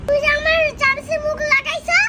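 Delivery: loud, indignant scolding; loud accusation. Real, not acted. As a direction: accuse her loudly. Child scolds about the spoon.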